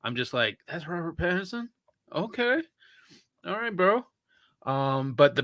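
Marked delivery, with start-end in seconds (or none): 0.7-4.1 surprised. Retold or imitated. Acting out own surprised reaction.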